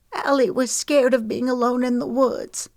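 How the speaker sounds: high, tearful tremble; trembling voice. Acted. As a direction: hold back tears as you say it. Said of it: "Her voice trembled".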